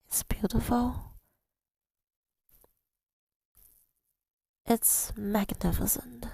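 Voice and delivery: carried away, softly marvelling; awed excitement. Real, not acted. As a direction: whisper it like you can't believe it. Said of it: "Sorry, I got so excited".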